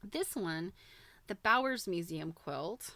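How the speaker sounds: ordinary delivery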